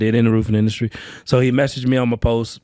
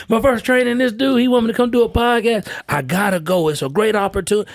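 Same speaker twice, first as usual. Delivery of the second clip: much higher, eager and breathless to share; telling baby mama about the podcast, pitched-up excited; excited news; 10 semitones higher than usual. Retold or imitated. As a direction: burst in with the big news.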